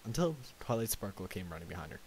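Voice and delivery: plain reading voice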